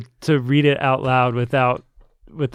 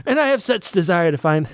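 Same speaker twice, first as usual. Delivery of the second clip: high, grand kingly reading; pompous royal voice; 6 semitones higher than usual. Acted. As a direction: read it like a pompous king. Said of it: "That's good stuff".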